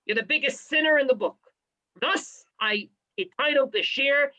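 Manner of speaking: usual speaking voice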